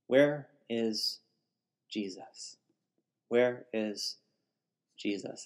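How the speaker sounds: hushed, searching question; fading almost to nothing; quiet pleading. Real, not acted. Desperate repeated question.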